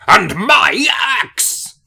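loud, drawn-out Scottish war cry; Scottish Gimli impression. Showing a cohost how the Scottish sound, hammed-up Scottish roar. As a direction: bellow it slowly in a hammed-up Scottish accent. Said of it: "Where the Scottish are like".

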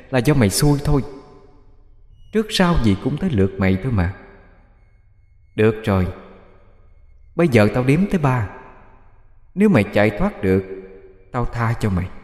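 a hint of a soft, menacing ghost; lightly voiced demon. A narrator's reading. The demon tells Bích he dies tonight, soft menace. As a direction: threaten quietly, savouring the kill.

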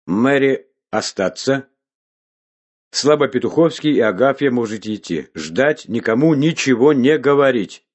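loud, high barked orders; commanding. A narrator's reading. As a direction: command like a bureaucrat. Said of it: "Он вдруг скомандовал".

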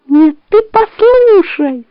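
high, slow insistent fox. A narrator's reading.

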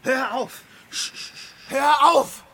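anguished cry. Acted. Antonius cries out to silence Hilarion. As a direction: scream at him to stop. Hermit begs him to stop.

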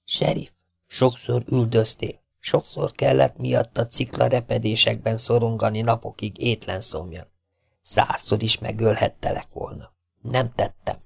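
soft-spoken outlaw warning of fire; menacing phantom. Acted. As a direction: keep it hushed and threatening. Phantom's voice in the dark.

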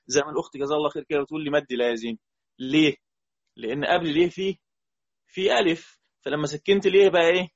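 ordinary delivery

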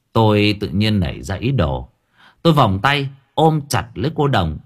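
ordinary delivery

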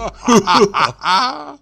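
high whinnying laugh; delighted drunken neigh. A narrator's reading. Drunk comic neighs with delight at the vodka. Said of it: "заржал от удовольствия".

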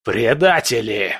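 a spat, venomous word; hissed fury. Acted. Prince hisses at his disloyal soldiers, venomous. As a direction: spit it out through clenched rage. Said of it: "прошипел Бобшильд".

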